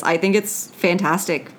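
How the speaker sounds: ordinary delivery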